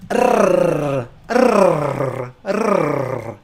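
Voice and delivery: loud Italian rolled rrr. Shown as a demonstration. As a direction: trill the tongue hard, rrr. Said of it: "or a rolled R, we also call it, is like an Italian".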